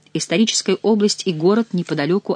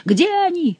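high-pitched, drawn wail; yelling; 13 semitones higher than usual. A narrator's reading. Favourite hunting for the enemy, yelling high. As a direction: cry it out high, without much volume. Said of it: "завопил Шомберг".

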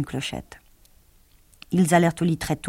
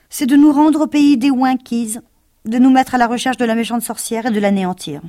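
higher, louder rallying call; determined lion; 6 semitones higher, 7 dB louder than usual. Acted. Lion rallying friends against the witch, determined. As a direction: rouse your friends to go.

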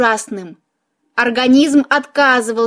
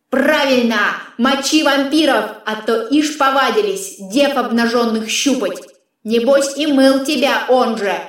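distant, filtered voice of a talking sword; filtered otherworldly sidekick; sword's disembodied voice. Acted. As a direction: speak as a disembodied companion, through an effect.

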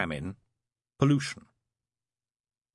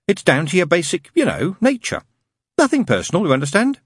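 prim, earnest angel voice, pitched higher; a fussy angel stating a rule sincerely; 9 semitones higher, 9 dB louder than usual. Acted. Angel telling the demon he can't do good, earnestly prim.

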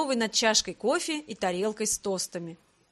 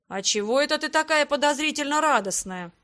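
wary question, pitched up; 5 semitones higher, 4 dB louder than usual. A narrator's reading. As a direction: ask with mounting suspicion. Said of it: "напрягся брат".

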